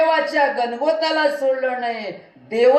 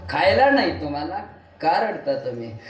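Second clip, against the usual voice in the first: ordinary delivery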